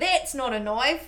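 party-trick Australian accent. Shown as a demonstration. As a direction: show off a broad Australian accent. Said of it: "I still can't do an Australian accent".

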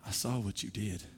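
soft, low, knowing; God quietly confronting a sinner, soft. Retold or imitated. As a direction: hush it like a secret. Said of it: "he comes to us and says".

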